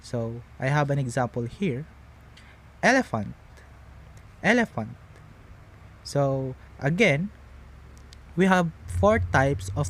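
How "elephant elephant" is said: EL-ephant, first syllable high, the rest falling; first-syllable stress. Shown as a demonstration. Teacher giving a dactyl example, first-syllable stress on Elephant. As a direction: hit the start of Elephant and drop away. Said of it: "putting a stress in the first".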